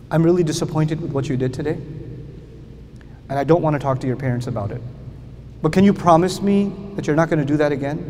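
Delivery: soft, fast, gentle reproach; quiet disappointment. Retold or imitated. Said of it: "I didn't yell at him".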